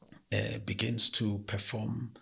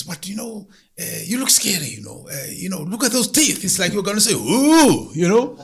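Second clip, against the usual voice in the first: high, louder nervous woman begging the lion; anxious woman pleading; 7 semitones higher, 7 dB louder than usual. Acted. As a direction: voice up high, nervously asking him to look away.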